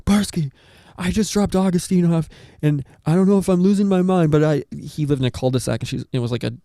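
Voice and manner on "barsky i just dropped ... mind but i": mock frantic friend. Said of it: "frantic and, like, crying".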